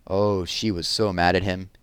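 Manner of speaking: plain reading voice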